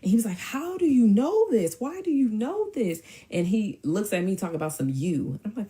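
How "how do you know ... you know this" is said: high, drawn-out accusing question; mock his outraged disbelief, pitched way up; loud, incredulous imitation; indignant demand. Acted quote of his angry challenge.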